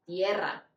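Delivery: "tierra" with an ie glide and rolled R; Spanish tierra. Shown as a demonstration. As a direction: say "tierra" as TYEH-rra.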